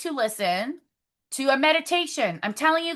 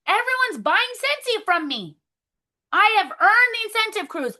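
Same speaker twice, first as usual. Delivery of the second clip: higher, bright affirmation; excited affirmation; 7 semitones higher than usual. Shown as a demonstration. Team leader reciting goals for her recruits, high and bright. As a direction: claim it like it's already true. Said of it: "I want you guys to say that".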